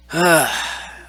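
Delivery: loud, high sigh; exasperated sigh. Real, not acted. Vlogger fed up with a troll, exasperated sigh. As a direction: let out one heavy, loud sigh.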